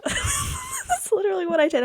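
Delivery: squealing laugh. Real, not acted. Laughing at her own reaction.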